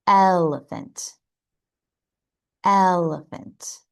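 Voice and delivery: "Elephant" slowly, opening on a clear short "eh"; short E practice. Shown as a demonstration. Teacher leading practice of the short E, slow model pronunciation. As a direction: drill "Elephant" with a slow, leaning first vowel. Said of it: "Let's practice".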